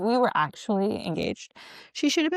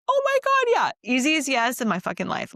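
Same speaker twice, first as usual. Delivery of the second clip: high, loud thrilled outburst; excited acceptance; 6 semitones higher, 4 dB louder than usual. Retold or imitated. Bride answering the proposal, excited and high. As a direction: burst out with a squealed yes.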